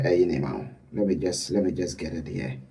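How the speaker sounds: low, very soft aside to oneself; quiet, low-pitched filler while hunting for the data; muttered aside. Real, not acted. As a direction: drop right down, half to yourself while searching.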